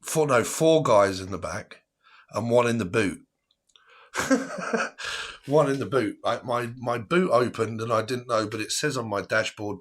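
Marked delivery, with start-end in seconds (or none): 4.1-5.4 high breathy laugh. Real, not acted. Laugh at one in the boot.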